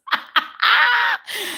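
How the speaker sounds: long, very high peal of laughter; high cackle. Real, not acted. Viewer sings fixing plates, host cackles high. Cracking up at singing comment.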